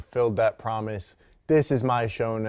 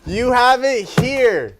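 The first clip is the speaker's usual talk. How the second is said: high, loud winner announcement; game show host call; 6 semitones higher, 6 dB louder, slower than usual. Acted. Host to the winning player, game show excitement. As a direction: crown the champion like a quiz host.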